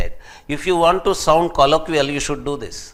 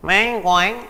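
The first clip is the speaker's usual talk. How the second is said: a touch of nasal Philadelphia English, a bit higher and louder; Philadelphia accent; 5 semitones higher, 5 dB louder than usual. Retold or imitated. Lecturer mimics the Philadelphia accent for students, lightly nasal. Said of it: "Philadelphian might be saying".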